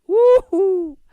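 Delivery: high, loud falsetto woo-hoo; excited whoop. Real, not acted. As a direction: whoop like the point just landed. Preacher whoops at his point.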